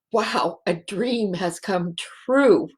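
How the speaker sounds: ordinary delivery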